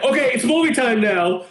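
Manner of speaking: put-on a dad settling the kids down; lightly voiced stern father. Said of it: "he did it in a very stern voice".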